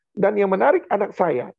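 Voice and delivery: ordinary delivery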